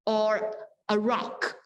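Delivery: normal talking voice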